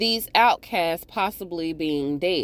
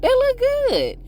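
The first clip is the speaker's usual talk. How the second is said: high excited gush; high louder admiration; 4 dB louder than usual. Real, not acted. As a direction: squeal the praise. Gushing over costumes.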